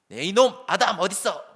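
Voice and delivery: loud, high, slow angry roar at Adam; harsh scolding. Shown as a demonstration. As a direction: bellow at him like a furious father. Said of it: "뭐 이렇게 불러". (화난 목소리로 세게 꾸짖으며 부르기)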